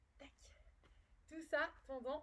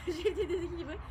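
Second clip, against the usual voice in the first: laughing; 4 semitones higher, 10 dB louder than usual. Real, not acted. Loses balance during the exercise.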